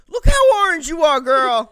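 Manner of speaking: a high, loud, shouted drag queen zinger; drag queen quote. Retold or imitated. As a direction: belt the catty line like the queen on the show. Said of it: "favorite fucking quotes is".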